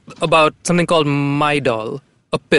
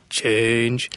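low, soft beggar's plea; mock beggar mumble; 4 semitones lower, 5 dB quieter than usual. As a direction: mumble it like a hobo asking for coins. Begging drunk people as hobo.

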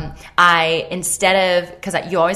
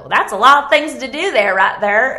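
put-on country accent; 7 semitones higher, 9 dB louder than usual. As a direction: imitate Cooper's country accent with amused surprise. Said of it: "Cooper said in a country accent".

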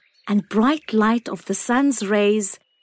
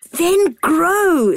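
bright, calling sun voice; the sun calling down kindly; warm beaming sun voice; 8 semitones higher, 4 dB louder than usual. A narrator's reading.

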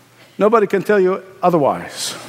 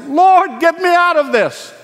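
desperate cry; 8 semitones higher than usual. Retold or imitated. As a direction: cry out to God to be rescued. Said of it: "our cry to the Lord is".

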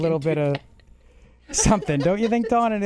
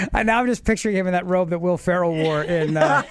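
ordinary talk, nothing marked

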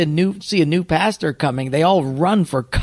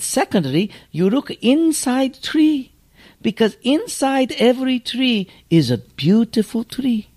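put-on unhurried, higher Japanese-accented master teaching; Mister Miyagi voice; 7 semitones higher than usual. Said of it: "so we'll just call him Mister Miyagi".

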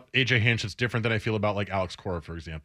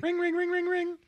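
high phone ring imitation; 5 dB quieter than usual.

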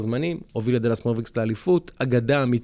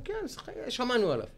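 dismissive mock; 10 semitones higher, 6 dB quieter than usual. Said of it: "אמרו".

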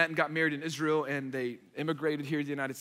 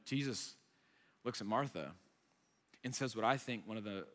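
ordinary delivery

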